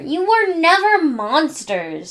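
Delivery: slow, smug gloat. Acted. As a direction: rub it in proudly. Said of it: "Angelica, gloating".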